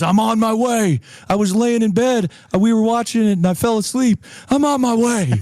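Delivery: high-pitched, eager rush of reassurance; excited retold reply. Retelling friend's excited phone reply.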